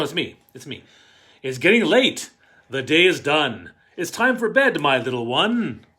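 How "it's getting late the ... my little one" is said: put-on loud, lively read-aloud to kids; a parent tucking a child in, read out loud and animated; storybook reading. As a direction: read it big for the children in the back row.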